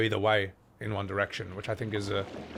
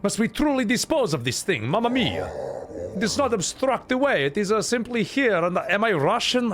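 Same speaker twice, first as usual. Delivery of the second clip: high, hammed-up accent sliding from Italian toward Russian; badly faked European accent, pitched way up; muddled foreign accent; 8 semitones higher than usual. Streamer botching a game character's voice, muddled foreign accent. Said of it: "I couldn't decide on the accent".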